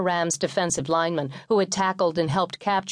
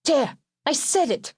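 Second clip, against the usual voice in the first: high, slow, emphatic finish; exasperated mother; 8 semitones higher, slower than usual. A narrator's reading. Mother ending her sons' squabble over grace, exasperated. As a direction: settle it slowly in a higher voice.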